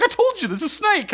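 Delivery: high, loud exasperated yell at the dog; mock frustrated yell. Dog owner yelling at his dog about a snake, mock frustrated. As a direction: play the fed-up owner scolding his pet. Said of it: "he's getting frustrated with the dog".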